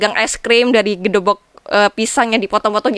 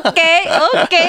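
laughing; 8 semitones higher than usual. Real, not acted. Amused after guest doesn't know.

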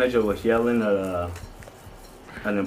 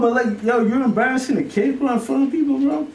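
heated high protest; 11 semitones higher than usual. Retold or imitated. Retelling telling off the manager.

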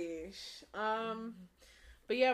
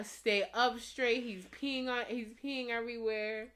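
normal talking voice

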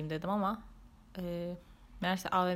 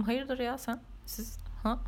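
indignant; 6 semitones higher, slower than usual. Retold or imitated. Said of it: "falan yapıyorum içimden".

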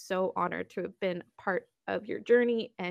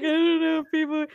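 high, loud mock-nervous voice; 8 semitones higher, 7 dB louder than usual. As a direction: act out nervous hesitation, pitched up. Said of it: "we were nervous, we were like".

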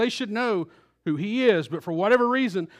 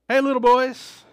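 mocking address; 4 semitones higher, 5 dB louder than usual. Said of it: "which would be insulting to them".

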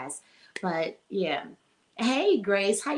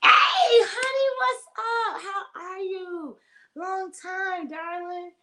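squealing hello; 12 semitones higher, 5 dB louder, slower than usual. Real, not acted. Host greets a viewer she missed, with high squealing delight. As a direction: squeal hi to a missed friend, then soften.

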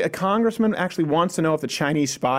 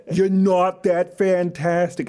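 slow, drawn-out New York mobster parody; fifties movie mobster; much slower than usual. Acted. Host parodies New York brothers telling critics off, mobster voice. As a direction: lay it on thick like a film gangster. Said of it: "parody of ourselves from a fifties mobster movie".

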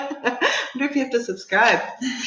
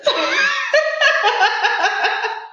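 corny dad laugh; 14 semitones higher than usual. Real, not acted. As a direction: cackle at your own punchline.

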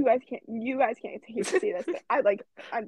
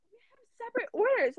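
high-pitched, huffy correction; imitated indignant customer; 12 semitones higher than usual. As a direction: mimic her indignation, pitched way up. Retold indignant customer correcting cashier.

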